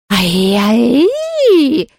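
high yelping ghost howl; pained howl. Acted. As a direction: howl as if burned. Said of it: "взвыло оно".

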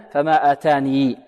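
آتاني said slowly with a sounded final ya; pausal form with ya. Shown as a demonstration. Teacher shows the second pausal option, slow. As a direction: stop on آتاني with the ya. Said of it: "وفي الوقف لو فيها وجهان".

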